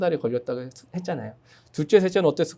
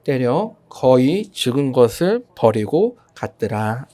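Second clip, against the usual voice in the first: slow, measured Bible reading; scripture reading; much slower than usual. Acted. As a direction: read the verse slowly and solemnly.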